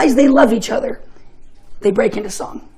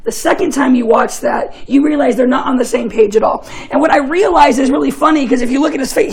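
ordinary talk, nothing marked

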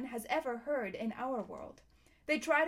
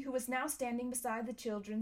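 ordinary delivery, nothing marked

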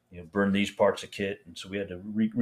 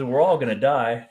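imitating an amused doctor, louder and higher; retold laughing delivery; 4 semitones higher, 7 dB louder than usual. Doctor joking to the wounded soldier, retold laughing delivery. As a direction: deliver it as a doctor's dark joke. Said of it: "he started laughing".

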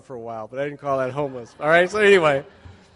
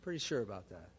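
much lower and softer, "Pretty sure about that" tossed off; wry quiet aside; 6 semitones lower, 14 dB quieter than usual. Shown as a demonstration. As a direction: drop it into a dry, wry undertone. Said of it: "I wanted to drink".

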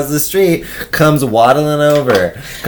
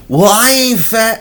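much higher mock indignant; offended stranger; 10 semitones higher than usual. Stranger correcting the insult, mock offended. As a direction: play her taking offence. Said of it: "she goes".

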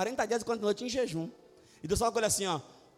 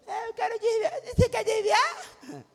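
mock high shout; 12 semitones higher, 3 dB louder, slower than usual. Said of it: "mock dialogue with wife".

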